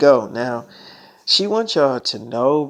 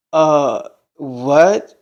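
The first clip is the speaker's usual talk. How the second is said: loud, stunned question; stunned disbelief; 7 dB louder than usual. Retold or imitated. Patient hears from the doctor she is pregnant, stunned. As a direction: blurt it out in disbelief.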